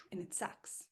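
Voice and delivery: hushed unvoiced whisper. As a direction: breathe it out as a whisper. Whispered low point.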